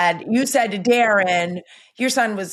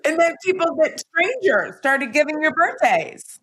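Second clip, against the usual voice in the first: talking through real laughter, higher voice; laughing; 6 semitones higher than usual. Real, not acted. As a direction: laugh your way through the words. Laughing after joke about birthdays.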